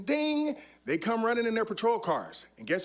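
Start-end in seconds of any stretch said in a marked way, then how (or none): 0.0-0.6 high sung ding; ping the word ding up high; high playful signal ding. Retold or imitated. Signal to partners.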